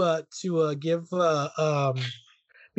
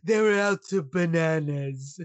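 James Mason impression. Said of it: "You sound more like James Mason".

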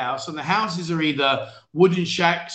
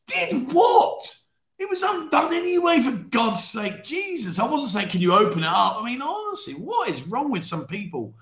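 high, incredulous outburst; baffled, exasperated indignation; exasperated disbelief; 6 semitones higher than usual. Real, not acted. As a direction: protest your innocence, pitch leaping up. Exasperated at viewers' complaints about the joke.

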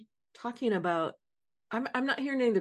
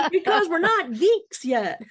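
laughing; 8 semitones higher, 7 dB louder than usual. Real, not acted. Laughing through the line.

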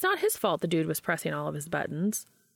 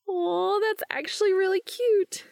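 higher, fond aww; cooing; 14 semitones higher, 4 dB louder than usual. A narrator's reading. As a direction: gush over the message. Charlie cooing at text.

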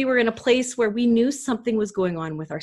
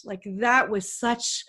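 ordinary delivery

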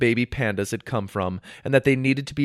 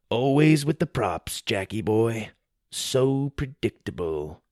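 smug taunt; 3 dB quieter than usual. Acted. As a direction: sneer it out lazily. Sawyer taunting defeated foe.

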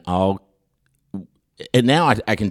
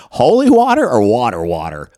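incredulous loud question; 3 semitones higher, 7 dB louder than usual. Retold or imitated. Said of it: "I said".

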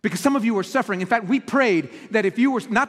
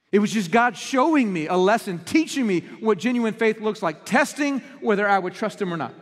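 ordinary delivery

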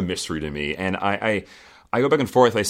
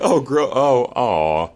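mock pity; 6 semitones higher, 5 dB louder, slower than usual. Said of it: "I was like".

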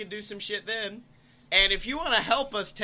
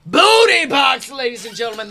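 announcer shout; 15 dB louder than usual. Acted. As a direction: belt it like a ring announcer. Announcer climax.

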